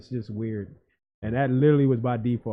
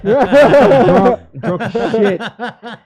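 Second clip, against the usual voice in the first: laughing; 10 semitones higher, 11 dB louder, much slower than usual. Real, not acted. Laughing while recalling.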